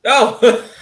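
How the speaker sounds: loud high laughing oh; laughing surprise. Real, not acted.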